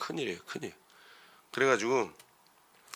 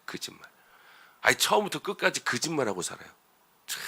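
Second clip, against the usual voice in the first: ordinary talk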